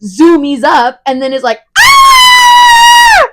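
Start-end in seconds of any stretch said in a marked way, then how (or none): 1.8-3.3 long, high, loud imitated scream; mock panicked scream. Retelling Neville zooming up, high mock scream. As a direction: scream like someone shooting skyward.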